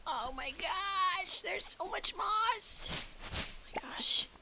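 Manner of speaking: high and very slow, drawn-out amazement; awed. Real, not acted. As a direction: marvel at it slowly. Awed at huge amount of moss.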